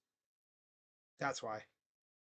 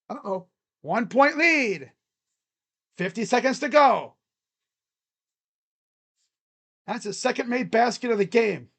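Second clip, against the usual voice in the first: louder, higher, racing call of the finish; excited play-by-play; 8 semitones higher, 16 dB louder than usual. Real, not acted. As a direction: call the last minute on the edge of your seat. Tight finish, go-ahead three.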